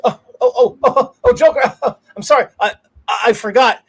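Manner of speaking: high, loud, frantic pleading; a scared henchman begging the Joker; panicky henchman. Retold or imitated. Henchman nervously apologizing to the Joker, high and frantic. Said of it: "And he goes".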